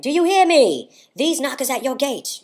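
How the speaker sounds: high, loud theatrical cry; dramatic monologue reading. Shown as a demonstration. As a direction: call out grandly to be heard. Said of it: "I had to be like".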